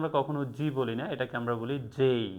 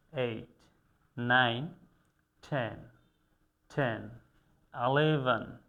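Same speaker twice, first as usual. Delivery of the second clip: ordinary talk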